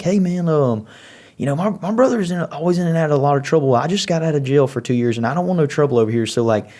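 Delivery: high, quick pleading of an ex-con; nervous brother voice. Retold or imitated. Brother on speakerphone begging Frankie, high nervous plea. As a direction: hurry to say you want no trouble.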